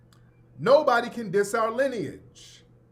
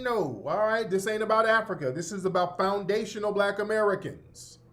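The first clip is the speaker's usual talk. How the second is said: ordinary talk, nothing marked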